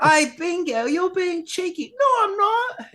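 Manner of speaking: very high squeaky cartoon kid voice; Bluey toy voices. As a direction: play the cartoon puppies for a toddler. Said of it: "like, the voices".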